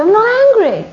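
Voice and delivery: loud, high-pitched indignant protest; angry shouted denial. Acted. Person accused of being angry hotly denies it, shouting. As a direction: deny it hotly, raising your voice. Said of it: "why are you shout".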